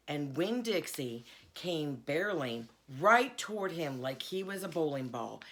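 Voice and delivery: ordinary delivery, nothing marked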